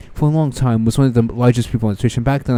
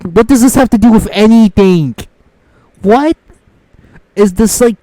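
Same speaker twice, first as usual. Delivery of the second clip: incredulous raised voice; 8 semitones higher, 5 dB louder than usual. Real, not acted. Ranting at privilege remark.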